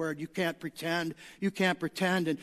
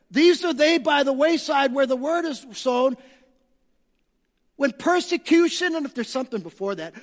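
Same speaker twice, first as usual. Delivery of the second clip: loud, high, fervent preacher's call; full-force preaching; 7 semitones higher, 7 dB louder than usual. Acted. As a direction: drive the text home from the pulpit.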